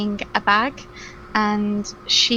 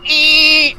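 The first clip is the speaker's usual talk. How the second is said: loud, higher screech of a scraping chair; chair scrape noise; 6 semitones higher, 9 dB louder than usual. Acted. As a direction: imitate a chair dragged across the floor. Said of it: "scraping against the floor".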